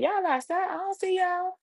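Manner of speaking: very high, eager questioning; excited disbelief. Retold or imitated. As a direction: squeal it down the phone to mom. Excited reply to mom on phone.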